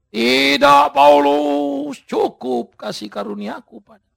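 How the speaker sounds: a loud, firm divine reply; God answering. Acted. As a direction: speak as God, settling it. God answers Paul firmly. (jawaban Tuhan yang tegas dan keras)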